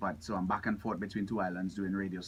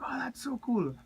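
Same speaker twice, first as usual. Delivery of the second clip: much higher fan gushing; imitating starstruck fans. Football fans learning about his uncle, starstruck imitation. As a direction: gush in a high, awed fan voice.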